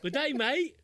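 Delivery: a bad Australian accent, played for laughs; jokey fake Australian greeting; mock Australian accent. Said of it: "Completely wrong country".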